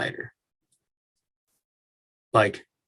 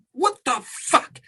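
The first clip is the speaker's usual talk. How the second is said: incredulous; 13 semitones higher, slower than usual. Real, not acted. Outraged at hypocrisy.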